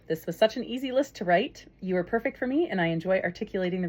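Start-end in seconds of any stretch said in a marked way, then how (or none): none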